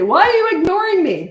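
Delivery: high, loud mock child's wail; tantruming toddler. Toddler to parent filing nails, wailing mock protest. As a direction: protest like an outraged toddler, exaggerated.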